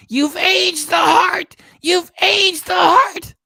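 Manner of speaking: very high, loud, drawn-out yell; exaggerated shouting. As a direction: holler it slowly, hammering each word. Mock-scolding streamer about her heart.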